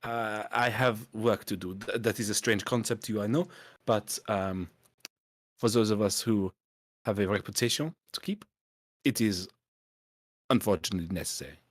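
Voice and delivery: hammed-up French accent, halting and searching for words; bad French accent. Snooty French innkeeper shooing the party off, bad French accent. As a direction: play it like a snooty Frenchman stumbling through English. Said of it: "my bad French accent".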